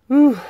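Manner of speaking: a high, loud breathless whew; puffing from the climb; winded whew. Real, not acted. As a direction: blow out hard after the effort. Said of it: "Sorry to be so out of breath".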